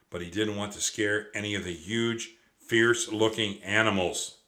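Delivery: usual speaking voice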